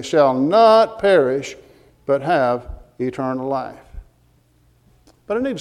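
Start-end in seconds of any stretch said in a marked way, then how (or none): 0.0-1.6 "not" lengthened and punched louder; emphatic NOT. Real, not acted. Preacher stresses what the verse says, emphatic "not". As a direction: drive home the word "not" in "shall not perish". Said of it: "He's emphatically saying".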